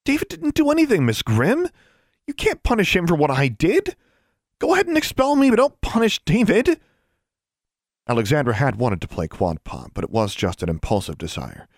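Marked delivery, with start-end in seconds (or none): 0.1-6.8 very high-pitched, indignant pleading; impassioned protest. Acted. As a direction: plead at the top of the voice for a friend. Alexandra pleads for her friend.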